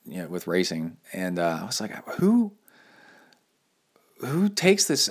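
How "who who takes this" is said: high-pitched, baffled disbelief; retold incredulity. Recalling a racer's bike-moving, incredulous disbelief. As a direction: exclaim it as if you can't believe it.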